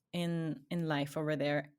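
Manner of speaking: ordinary delivery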